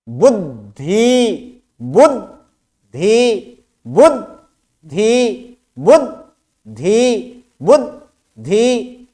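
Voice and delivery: बुद्धि drawn out very slowly, half द then full ध; slow syllable drill. Shown as a demonstration. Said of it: "तो इसमें पता चलता है कि द आधा ही है". (बुद्धि को धीरे-धीरे, आधा द और पूरा ध बोलें)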